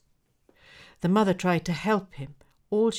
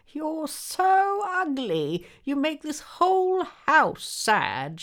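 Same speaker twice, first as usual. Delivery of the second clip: high, slow, loud scolding crone; shrill old woman; 12 semitones higher, 7 dB louder, slower than usual. Acted. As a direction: screech it at him like a cross old woman. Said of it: "she said".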